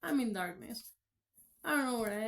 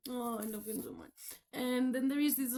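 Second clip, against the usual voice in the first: ordinary talk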